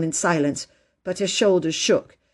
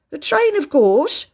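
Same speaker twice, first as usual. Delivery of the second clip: loud, high, drawn-out answer; matter-of-course porter; 9 semitones higher, 5 dB louder, slower than usual. A narrator's reading. Porter answering Phyllis's question, emphatic. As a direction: answer slowly as if it were obvious.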